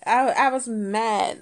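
normal talking voice

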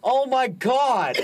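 high, loud, drawn-out laughing exclamation; laughing disbelief. Real, not acted. Guest realizing she forgot she's mentally ill, laughing disbelief. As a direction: stretch it out through real laughter.

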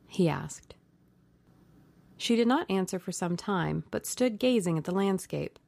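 ordinary delivery, nothing marked

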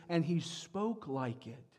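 normal talking voice

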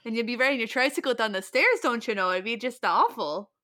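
hammed-up Minnesota fretting; Minnesota accent. Minnesotan laments a household going to pot, Minnesota accent. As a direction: fuss in a broad Minnesota accent. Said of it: "insulting accent for Minnesotans".

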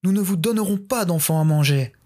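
high, frightened refusal; tearful refusal. Acted. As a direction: hold out through tears. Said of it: "répondirent les villageois en pleurs".

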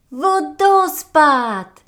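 a long, high, loud cry of joy; shrieking marmot. A narrator's reading. Marmot shrieks at finding the waterfall, overjoyed. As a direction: shout it out at the first sight. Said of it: "wrzasnęła marmotka".

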